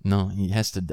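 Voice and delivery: ordinary talk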